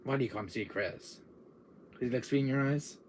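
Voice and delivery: normal talking voice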